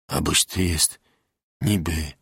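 low, halting peasant husband; tongue-tied gratitude. A narrator's reading. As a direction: grope for words, low and unsure. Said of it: "nie umiał tego wyrazić". (nisko, nieporadnie, z wdzięcznością)

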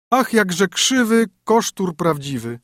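high, teasing sing-song rhyme; a haughty princess mocking a fat knight in verse; princess mocking a suitor. A narrator's reading. Princess sneering at a suitor, teasing and high.

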